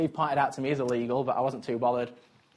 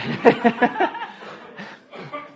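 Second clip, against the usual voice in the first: unvoiced chuckle; 8 semitones higher than usual. Real, not acted. Laughing at own question.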